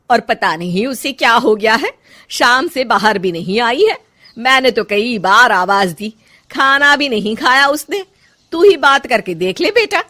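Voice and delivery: loud, animated fretting; mother-in-law voice, worried and complaining; concerned mother. Acted. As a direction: report the trouble to your son. Mother tells son her worry.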